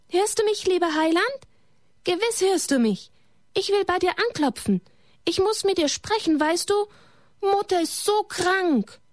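put-on a small boy's high voice calling out to the Saviour; high, ringing plea of a child; calling out loud. A narrator's reading. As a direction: call up high and pleading into the empty church. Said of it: "hörte seine eigene Stimme in der Kirche laut schallen".

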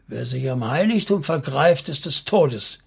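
ordinary delivery

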